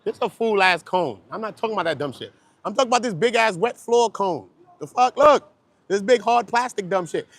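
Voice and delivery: loud, high, indignant rant; retold outburst. Retelling the argument over the cone, loud and indignant. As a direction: rant about the stupid cone, worked up. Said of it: "I'm like".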